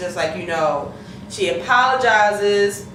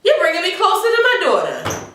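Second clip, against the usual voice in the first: grateful Charlie; 12 semitones higher than usual. Retold or imitated. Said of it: "he's looking at Papa Pope like".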